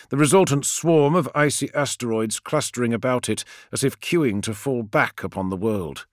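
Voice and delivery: usual speaking voice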